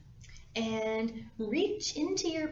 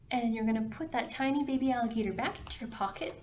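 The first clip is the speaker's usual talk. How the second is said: usual speaking voice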